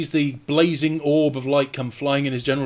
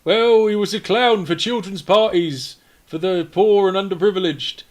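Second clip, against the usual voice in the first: mock outraged grief; 6 semitones higher, 8 dB louder than usual. Game master voices cultist accusing the killers, mock outraged grief. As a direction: build from eulogy to loud, hammed-up accusation.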